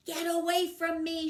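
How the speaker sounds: loud and high; shouting. A narrator's reading. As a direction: yell it to drive him off. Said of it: "she shouted".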